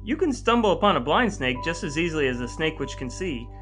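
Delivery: ordinary delivery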